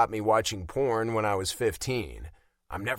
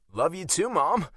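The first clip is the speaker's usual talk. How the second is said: touch of choked emotion, pitched higher; 8 semitones higher, 3 dB louder than usual. A narrator's reading. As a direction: get it out past a lump. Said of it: "I choke out".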